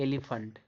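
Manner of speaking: "एलिफेंट" ending softly in -funt; soft "एलिफेंट" with uh in the last syllable; correct pronunciation. Shown as a demonstration. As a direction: say "एलिफेंट" as eli-funt, falling. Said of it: "इसको पढ़ा जाता है".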